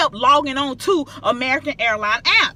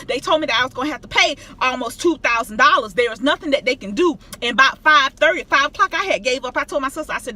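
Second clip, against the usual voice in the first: normal talking voice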